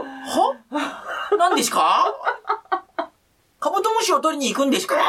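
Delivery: put-on high, laughing, baffled little kid. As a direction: play the clueless neighborhood kid. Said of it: "みたいな".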